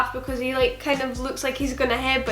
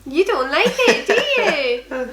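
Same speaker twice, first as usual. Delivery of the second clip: put-on baby talk; 5 semitones higher, 3 dB louder, slower than usual. Parent talking to baby.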